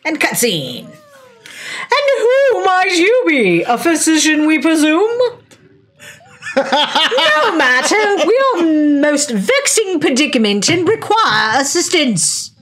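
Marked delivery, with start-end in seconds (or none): 0.9-5.2 very high, slow regal voice; queen bee voice. Acted. Voicing queen bee cutscene. 6.6-12.5 very high, slow regal voice; queen bee voice. Acted. Voicing queen bee cutscene.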